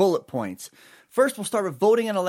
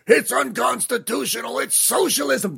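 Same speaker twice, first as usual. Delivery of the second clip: high-pitched mock-outraged headline; mock alarm; 6 semitones higher than usual. Host parodying right-wing websites, in mock alarm.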